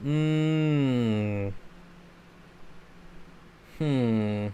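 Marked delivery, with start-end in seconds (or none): none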